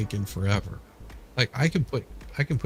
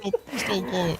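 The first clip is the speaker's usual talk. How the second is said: begging an ex back; 8 semitones higher, 4 dB quieter than usual. Pretend. Mock pleading, laughing.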